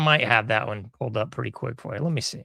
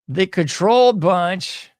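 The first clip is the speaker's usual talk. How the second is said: louder, slow, higher showman call; catchphrase announcement; 5 semitones higher, 6 dB louder, slower than usual. Acted. Host showing the clip on screen, announcer style. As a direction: boom out the title like a ringmaster.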